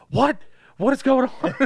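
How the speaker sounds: a small son totally lost; mock confused kid. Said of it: "He's like".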